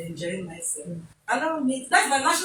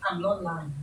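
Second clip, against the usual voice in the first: soft, low, calm "I'm not lying"; calm denial; 7 semitones lower, 7 dB quieter than usual. Shown as a demonstration. Advising a spouse how to answer calmly, soft and low. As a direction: deny it calmly and quietly. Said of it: "You come and say".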